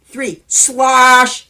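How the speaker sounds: plain reading voice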